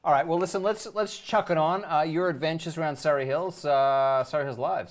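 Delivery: loud, high, pumped-up host intro; announcer voice. Real, not acted. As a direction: sell it like a show announcer. Host announcing the video clip.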